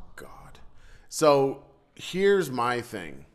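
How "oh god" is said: breathy whispered groan. Real, not acted. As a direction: mutter it under your breath. Unvoiced aside.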